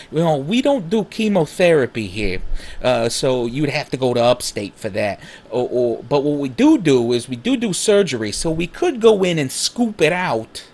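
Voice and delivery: ER doctor bluntly breaking bad news in a comic put-on accent. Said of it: "funnier to do it in it".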